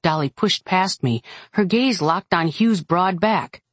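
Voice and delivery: usual speaking voice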